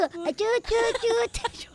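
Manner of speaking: put-on playful, slow, high baby talk. Host teases cohost with silly baby talk, slow and high. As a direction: coo it like to a baby.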